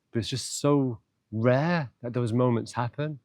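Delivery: normal talking voice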